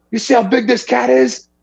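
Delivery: mock the worked-up guy's excitement; fired up. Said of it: "He was all fired up about it".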